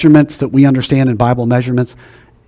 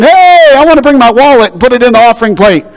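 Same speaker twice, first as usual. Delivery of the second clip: much higher, louder excited call; eager new convert; 10 semitones higher, 7 dB louder than usual. Retold or imitated. New convert offering his wallet, high and louder. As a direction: bounce in with the wallet, fast. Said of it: "the new convert saying".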